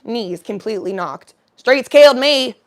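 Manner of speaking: two voices, the last line loud; playing both parts. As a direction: switch voices for each line. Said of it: "I wanna do both parts".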